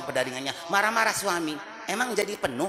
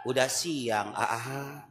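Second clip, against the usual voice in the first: low, soft coaxing to a sleeping husband; tender wake-up; 9 semitones lower, 4 dB quieter, much slower than usual. Retold or imitated. Wife softly waking her sleeping husband, lower and tender. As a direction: coo him awake gently.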